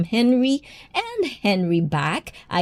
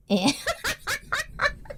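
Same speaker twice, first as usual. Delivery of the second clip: sudden high-pitched laugh; 8 dB quieter than usual. Real, not acted. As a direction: burst into a squealing laugh. Streamer bursts out laughing.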